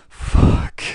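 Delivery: sudden frustrated shout; loud curse. Real, not acted. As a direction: blurt it out in anger. Big hit lands, frustration.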